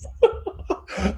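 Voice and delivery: high breathy burst of laughter. Real, not acted. Host laughing about Tiger King, high unvoiced laugh. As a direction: laugh out at the craziness.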